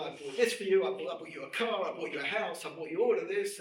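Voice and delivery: loud, bragging showman; boastful rich man. Retold or imitated. Rich American boasting loudly to his Russian relative. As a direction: flaunt every gift, loud and proud.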